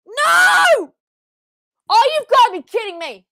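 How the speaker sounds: very high loud cry of disbelief; outraged shout. Real, not acted. Fan seeing Bronson eliminated, outraged high shout. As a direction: howl at the result.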